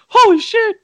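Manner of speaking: high, loud startled cry; shocked exclamation. Retold or imitated. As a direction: yelp it in real shock. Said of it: "And I was like".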